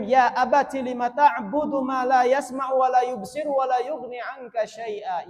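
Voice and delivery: loud, high, impassioned Arabic recitation; spirited recitation. Real, not acted. Preacher quoting Ibrahim to his father, spirited recitation. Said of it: "saya bacanya pertama karena semangat".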